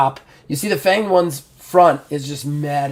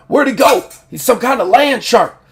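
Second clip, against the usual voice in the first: high, loud acted bewilderment; baffled outcry; 9 semitones higher, 6 dB louder than usual. Game master as foe losing a burrowing creature, baffled outcry. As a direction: exclaim it in startled disbelief. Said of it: "He's like".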